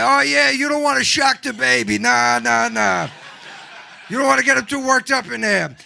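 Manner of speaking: loud, insistent smoker mom. Acted. Smoker excusing smoking while pregnant, loud and insistent. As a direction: push the bad advice out loudly. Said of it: "something a smoker made up".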